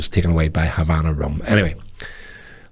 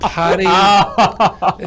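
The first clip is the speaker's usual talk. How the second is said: "Paddy" high and laughing in a bad American accent; 12 semitones higher, 5 dB louder than usual. Repeating the star's greeting to his friend, laughing bad American accent. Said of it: "it sounds like really bad".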